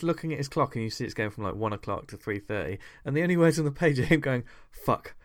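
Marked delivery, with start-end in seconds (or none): 4.7-5.1 mounting frantic swearing, each louder than the last; building swears. Retold or imitated. Sleepless man panicking at his clock, swearing louder and louder. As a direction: start muttered and build to an outburst.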